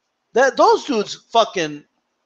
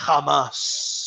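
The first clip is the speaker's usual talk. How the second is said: "Jamás" drawn out, lower, with a long hissed final s; Margarito; 5 semitones lower, 5 dB quieter than usual. Retold or imitated. Quoting the boxer's best line, "Jamás" held very long. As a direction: let the end of "Jamás" trail on and on. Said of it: "say the word "jamás" for that long".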